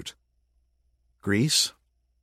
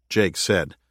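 normal talking voice

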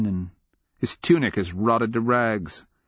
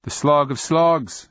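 lame; 7 semitones higher, 4 dB louder than usual. A narrator's reading. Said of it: "she says lamely".

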